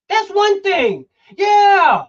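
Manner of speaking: loud, high and hard, driving the point home; heated shout. Real, not acted. Host ranting to his co-host, shouting in frustration. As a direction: shout it out like you've had enough. Heated rant about second chances.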